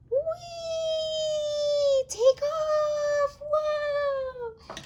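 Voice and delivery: very high, long-held squeals; playful takeoff squeal. Pretend. Toy flies off with the baby, squealing glee.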